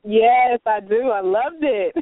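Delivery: ordinary delivery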